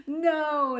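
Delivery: a laughing no. A narrator's reading. Cobbler laughing at the king's question, laughing no. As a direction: laugh the word out. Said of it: "laughed the man".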